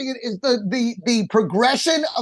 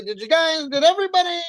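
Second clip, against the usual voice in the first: mocking a friend; 6 semitones higher than usual. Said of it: "I love it when you double back".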